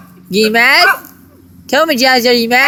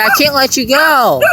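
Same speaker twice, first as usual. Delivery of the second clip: normal talking voice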